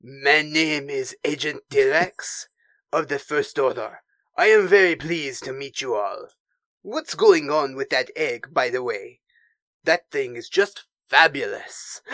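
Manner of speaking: French-accented cartoon smoothie introducing himself. Agent Terex greeting everyone, suave French accent. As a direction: put on a Pepe Le Pew French accent. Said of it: "read some of this dialogue in the voice".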